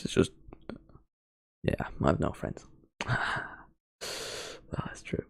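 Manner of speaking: breathy self-mocking whisper under the breath; whispered aside. Real, not acted. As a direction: drop to a whisper for the joke. Self-deprecating aside after joke.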